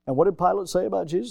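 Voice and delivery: usual speaking voice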